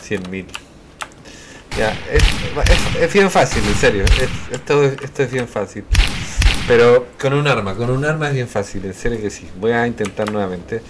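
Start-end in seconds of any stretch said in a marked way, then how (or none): 1.8-8.8 excited insisting. Real, not acted.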